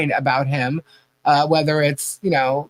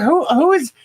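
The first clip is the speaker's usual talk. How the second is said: higher, baffled retelling; pitched up in disbelief; incredulous; 9 semitones higher than usual. Retold or imitated. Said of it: "I'm like".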